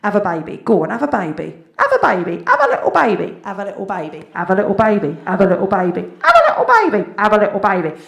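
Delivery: put-on loud, insistent chant of have a baby; nagging inner voice. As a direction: biological clock nagging her. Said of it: "I'll just hear".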